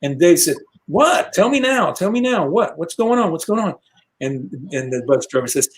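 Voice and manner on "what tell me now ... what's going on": high, urgent panicked questions; panicked demand. Retold or imitated. Dave woken to bad news, demanding answers in a panic. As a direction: pester him, higher and higher in alarm.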